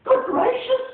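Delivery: very high, loud startled cry; gasped surprise. Acted. Maisie recognizes Horton, high startled cry. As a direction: gasp it out in shock. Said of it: "she gasped".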